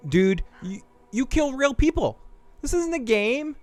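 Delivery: high, pleading imitation of her; pleading in disbelief. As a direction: plead as if stunned. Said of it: "She's like".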